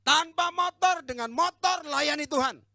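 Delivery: high, loud, ringing declaration; full-force pulpit shout, slowing at the end; forceful proclamation. Real, not acted. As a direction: proclaim it to the whole congregation. Preacher proclaims his resolve.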